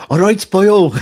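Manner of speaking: high, loud, jokey Welsh greeting; mock a friend back from Wales with a broad Welsh accent; teasing Welsh accent, pitched high and loud; hammed-up Welsh accent. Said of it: "I might have gained a little bit of that".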